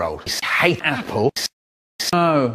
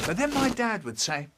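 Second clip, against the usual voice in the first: normal talking voice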